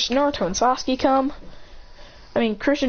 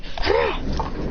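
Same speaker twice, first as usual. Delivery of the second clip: short voiceless rasping breath; quick mouth-made flame noise; imitated fire burst. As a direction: puff out a blast effect.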